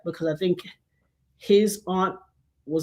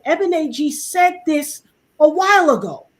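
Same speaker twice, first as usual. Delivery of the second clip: high-pitched, exclaimed quote; retold exclamation; 8 semitones higher, 3 dB louder than usual. Friend announcing to others about an old post, exclaimed high. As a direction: proclaim it high, as the friend would.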